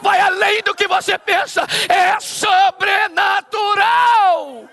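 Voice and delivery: rising shouted sermon climax; preaching at full force. Acted. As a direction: build to a shouting prophetic peak. (pregação inflamada, subindo até gritar)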